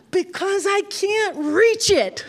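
put-on high, slow child's voice; a toddler explaining to grandma; whiny little boy. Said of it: "And he goes".

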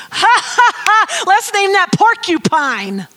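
loud, high laughing delight; Adam laughing. Pretend. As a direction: burst out laughing as Adam naming a silly animal. Adam laughing at a funny animal.

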